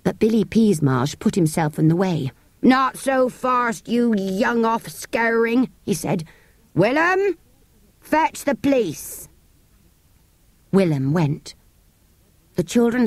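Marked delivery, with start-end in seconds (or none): 2.6-9.3 menacing horse dealer. Acted. Dealer blocks the children's escape.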